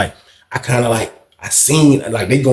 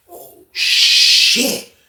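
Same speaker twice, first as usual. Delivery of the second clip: whispered; 12 semitones higher than usual. Retold or imitated. Shocked reaction.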